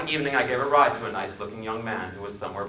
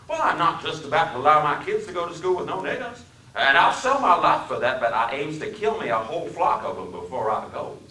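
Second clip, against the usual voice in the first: put-on menacing Southern accent; 7 semitones higher, 5 dB louder than usual. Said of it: "His speech was the deepest South".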